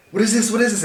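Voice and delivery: high, loud startled exclamation; alarmed cry. Retold or imitated. Patient seeing blood drip, a loud shocked cry. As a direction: yelp it out in shock. Sees blood dripping from needle.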